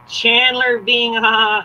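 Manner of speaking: put-on drawn-out, high-pitched sitcom girlfriend voice; Janet impression. Imitating Chandler's on and off girlfriend from Friends, high drawn-out impression. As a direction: stretch it out high in a put-on character voice. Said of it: "She's like".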